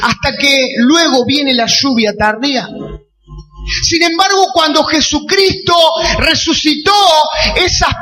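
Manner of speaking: full-force pulpit delivery, words stretched for weight; fiery preaching. Acted. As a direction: preach it to the back row.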